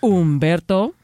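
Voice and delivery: a light Italian roll on "Umberto"; Italian-accented name. Retold or imitated. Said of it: "She does this weird voice with it".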